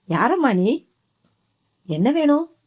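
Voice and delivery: a hint of a puzzled grandmother; gently questioning an unknown girl; old grandmother asking.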